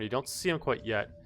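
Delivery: ordinary talk, nothing marked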